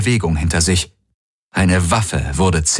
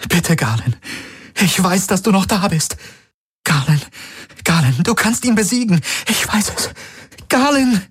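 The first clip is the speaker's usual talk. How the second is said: high-pitched desperate plea; pleading; 13 semitones higher than usual. A narrator's reading. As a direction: beg someone to fight his way back. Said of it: "Sie sah ihn flehend an". (verzweifeltes, hohes Flehen)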